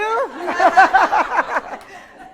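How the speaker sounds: high, loud burst of laughter. Real, not acted. Teacher laughs at own joke with class, loud laughter.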